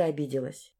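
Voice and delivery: ordinary delivery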